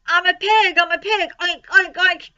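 high, loud playful squeals; excited boy's shouting. A narrator's reading. Boy playing pig in the crate, shouting gleefully. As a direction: shout it gleefully, oinking along.

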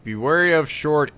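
ordinary delivery

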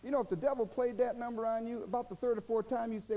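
ordinary talk

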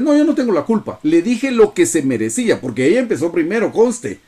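voz aguda de quien se excusa, actuada; justificación orgullosa. Acted. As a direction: defenderse con indignación exagerada, en tono agudo. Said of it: "porque nos estamos justificando". (autojustificación indignada y fingida)

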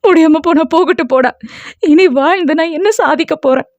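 high, wailing self-pity; tearful despair. A narrator's reading. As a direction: lament in a high, lightly acted cry. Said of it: "Kokila தேம்பி தேம்பி அழுது".

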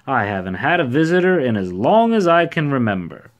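high, loud delight; happy cry. A narrator's reading. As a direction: gush happily to a visitor. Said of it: "he cried happily".

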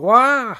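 high, loud cartoon yelp as the ground gives way; a quick startled "Wah!", pitched high; mock cry. Reviewer voicing Sonic falling into the hole, high loud yelp.

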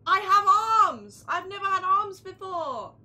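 excited exclamation. Real, not acted. Streamer discovers the character has arms, excited shout. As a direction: belt it out in amazement.

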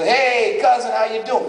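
put-on New York accent, punchy. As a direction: hail a relative like a New Yorker. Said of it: "He's kinda like a New Yorker".

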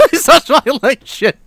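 high-pitched, laughing through the words; helpless laughter. Real, not acted. Streamer watching Dennis's bloopers, cracking up. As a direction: barely get it out for laughing.

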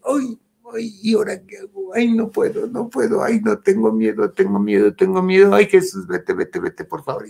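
high-pitched frightened pleading; reliving a fright in a high, anxious voice; retold panic. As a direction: act out a scared plea to be left alone. Retelling her terrified panic at night.

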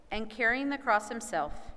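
ordinary delivery, nothing marked

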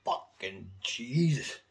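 slow, drawn-out awestruck curse; stunned reaction. Retold or imitated. First hearing the album, slow amazed curse. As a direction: let it sink in slowly, amazed. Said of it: "I put it on, it was like".

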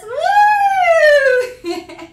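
long, loud, high whoop; piercing celebratory "woo"; excited whoop. Real, not acted. Cheers a donation.

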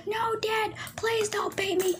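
high, begging son voice; pleading. Acted. Son begs dad not to beat.

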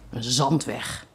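father groans at the dirt road; groaning. A narrator's reading. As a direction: groan it out in dismay. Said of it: "kreunde Bailey".

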